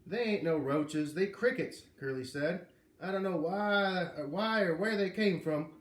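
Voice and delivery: slow Southern drawl. A narrator's reading. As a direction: stretch it out like a country foreman. Foreman's drawling country voice.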